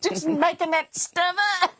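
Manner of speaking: put-on a little boy shrugging off the question; imitating a child. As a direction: son admits he may be making it up. Mocking kid making things up.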